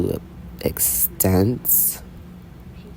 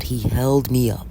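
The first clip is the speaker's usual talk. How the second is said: ordinary delivery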